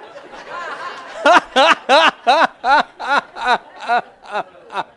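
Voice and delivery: loud, high, booming laugh; real laugh from the pulpit; big laugh. Real, not acted. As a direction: let a huge laugh roll out. Preacher cracks up mid-sermon.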